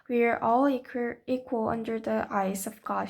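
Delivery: plain reading voice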